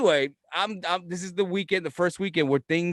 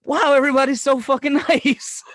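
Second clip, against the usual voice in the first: loud, high, hammed-up wonder; amazed delight; 11 semitones higher, 8 dB louder than usual. Newcomer marveling at friendly Florida locals, gushing delight. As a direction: gush in wide-eyed amazement, breaking into a laugh.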